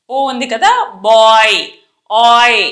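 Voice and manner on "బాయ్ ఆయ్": put-on BOY with a loud, open, stretched ఆయ్; teacher's emphatic model of బాయ్; OY vowel. Shown as a demonstration. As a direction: lean into బాయ్ and hold the vowel. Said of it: "ఆయ్ అన్నట్టుగా పలకాలి".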